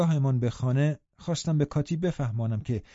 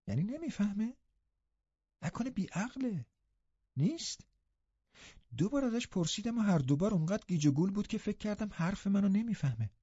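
put-on high, hushed girlish earnestness; very serious questioning; 7 semitones higher, 6 dB quieter than usual. A narrator's reading. Girl asking whether her cousin is witless, very serious. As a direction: ask in earnest puzzlement, high and soft. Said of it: "دوشیزه کاتی خیلی جدی پرسید".

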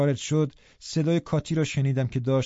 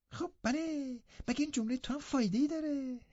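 mocking imitation; 13 semitones higher, 9 dB quieter than usual. A narrator's reading. Youth aping his rustic cousin to shame him, mocking imitation. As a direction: parrot him in a silly high voice. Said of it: "لینتون ادای هیرتون را درآورد".

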